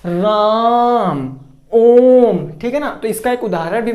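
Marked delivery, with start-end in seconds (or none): 0.0-1.3 राम stretched very long as a pluta vowel; drawn-out राम. Shown as a demonstration. Teacher demonstrating a pluta vowel, stretching राम slowly. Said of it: "जैसे". 1.7-2.5 ओम held long and much louder; drawn-out ओम. Shown as a demonstration. Teacher showing students a pluta vowel, loud stretched ओम. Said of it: "ओम को खींच कर बोल रहे हैं".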